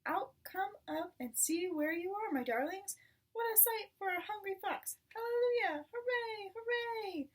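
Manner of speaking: high, loud cheering fox; jubilant. A narrator's reading. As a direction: cheer like a starving fox who found a feast. Fox rejoices over the chickens.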